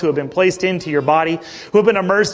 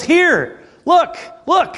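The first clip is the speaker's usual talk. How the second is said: put-on much higher, sweet little-girl "Here"; 11 semitones higher, much slower than usual. Father imitating daughter Reagan offering herself, high girl voice. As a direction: squeak it up like a proud toddler.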